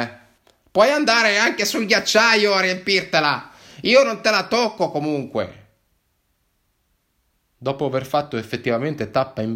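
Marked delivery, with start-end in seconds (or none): 0.7-5.6 high, loud, sharp voice of a fed-up barista woman; scolding barista woman. Acted. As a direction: barista losing patience, shouting at a pushy customer. Barista snaps back at narrator.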